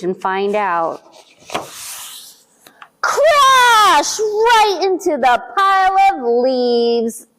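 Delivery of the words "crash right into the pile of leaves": dramatic crash. A narrator's reading.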